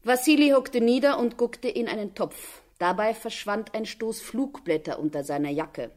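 ordinary talk, nothing marked

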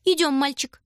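high friendly call; lightly voiced nurse; cheerful young woman. A narrator's reading. Смешливая девушка зовёт. (звонко, весело)

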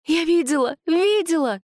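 high, loud gasping exclamations; breathless. A narrator's reading. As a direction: gasp it out in shock. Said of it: "судорожно выдохнула Света".

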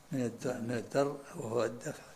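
ordinary talk, nothing marked

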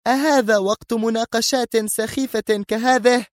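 very high-pitched, exasperated outburst; furious. A narrator's reading. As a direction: explode at the foolish questions in a high voice. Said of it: "تفجر غضب الدنيا في ملامحها وهي تهتف". (بغضب متفجر)

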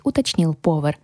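ordinary delivery

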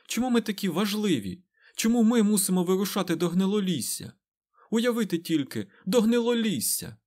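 high, agitated string of protests; exasperated outburst. A narrator's reading. Mat balks at the dangerous journey, exasperated. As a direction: protest in disbelief, pitch jumping up. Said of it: "не витримав Мед".

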